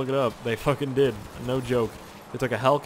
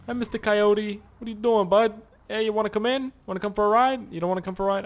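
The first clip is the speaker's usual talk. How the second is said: coaxing an animal; 8 semitones higher, 4 dB louder than usual. Acted. Talking to a coyote.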